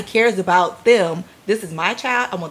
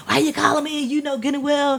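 scolding parent; 7 semitones higher than usual. Retold or imitated. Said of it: "I'm not gonna be like".

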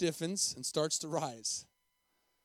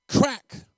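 loud, high voiced whip crack; belt crack; 8 semitones higher, 11 dB louder than usual. A narrator's reading. Preacher narrates the beating, loud sharp crack.

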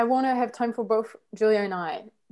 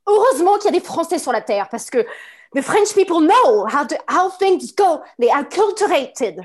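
put-on haughty, louder French-accented rant; French anger; 3 semitones higher, 5 dB louder than usual. French person lectures the world on culture, indignant French anger. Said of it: "go into this French anger".

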